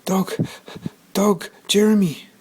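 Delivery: put-on high, loud gasping boy; boy out of breath telling grandmother, gasping; breathless. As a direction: gasp out the words after running home. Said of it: "was all he could manage".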